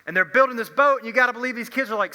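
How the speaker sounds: ordinary talk, nothing marked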